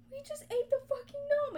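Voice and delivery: high, loud shocked shriek; a high, loud, disbelieving cry. Retold or imitated. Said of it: "and we're like".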